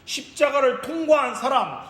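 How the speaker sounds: high, impassioned sermon proclamation; full-force preaching. Real, not acted. Preacher declares victory of the cross, full-force preaching. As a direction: proclaim it with rising fervour to the congregation.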